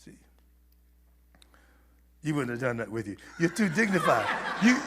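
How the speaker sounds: usual speaking voice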